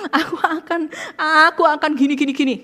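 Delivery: high, loud mock rehearsing of excuses; hammed-up babbling excuse; mock defensive voice. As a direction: ham up someone planning to argue. Continues mocking defensive speech.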